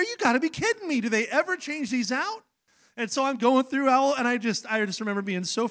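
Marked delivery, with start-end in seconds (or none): 0.0-2.4 exasperated. Retold or imitated. Retelling the stale crackers at the diner, exasperated and high. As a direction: voice jumps higher, mock disbelief.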